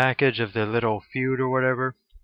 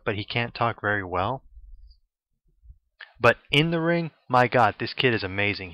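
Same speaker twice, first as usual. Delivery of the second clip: ordinary talk, nothing marked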